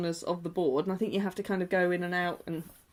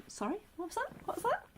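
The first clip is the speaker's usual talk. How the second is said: high mock puzzled query; 14 semitones higher, 10 dB quieter than usual. Retold asking shopkeeper to repeat.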